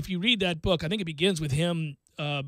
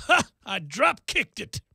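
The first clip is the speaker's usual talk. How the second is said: imitated chuckle; 4 semitones higher, slower than usual. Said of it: "he chuckles and goes".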